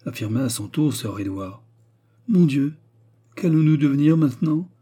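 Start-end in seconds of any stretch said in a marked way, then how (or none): 2.3-4.7 high, loud anxious lament; frightened young miss. A narrator's reading. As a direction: lament in dread of what comes next. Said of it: "soupira la jeune miss en jetant un regard d'épouvante". (plainte angoissée, voix haute)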